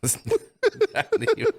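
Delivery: laughing. Real, not acted. Laughing protest.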